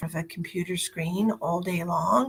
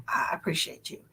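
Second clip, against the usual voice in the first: whisper; 5 dB quieter than usual. Real, not acted. Repeating thanks in whisper.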